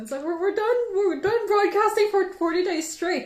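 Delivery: gushing as the forty days end; sentimental excitement. Real, not acted. Host to stream viewers, gushing with emotion at the finale. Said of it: "I'm such a sentimental person".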